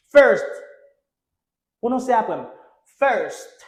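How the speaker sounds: normal talking voice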